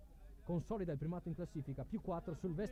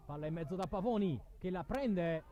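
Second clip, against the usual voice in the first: higher and louder, celebrating the whistle; jubilant final call; 3 semitones higher, 4 dB louder than usual. Real, not acted. Commentator hails the home win at the final whistle, jubilant. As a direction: let the joy burst out at the final whistle.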